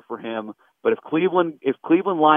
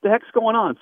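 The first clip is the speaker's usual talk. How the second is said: higher, imitating impatient Boston fans; mock Boston listeners; 8 semitones higher than usual. Host mimics Boston callers mocking the guest, higher mock gripe. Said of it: "They're like".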